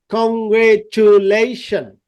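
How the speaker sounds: "Congratulation" slowly, evenly sounded out; syllable-by-syllable. Shown as a demonstration. Teacher sums up the sounds, "congratulation" in slow distinct syllables. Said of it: "পাঁচটা syllable".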